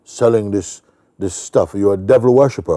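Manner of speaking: loud, high accusing challenge; elderly man accusing the seller of devil worship; strong forceful voice. Retold or imitated. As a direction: demand an answer, loud and forceful. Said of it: "in a very strong voice".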